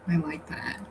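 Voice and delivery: breathy, hushed whisper; whispered repeat. Shown as a demonstration. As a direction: whisper it back to yourself. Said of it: "repeats it as whisper".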